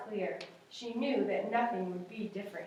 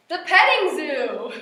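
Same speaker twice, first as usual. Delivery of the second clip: loud higher announcement; excited reveal; 4 semitones higher, 12 dB louder than usual. A narrator's reading. Teacher reading to children, loudly revealing the petting zoo. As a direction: land the happy surprise.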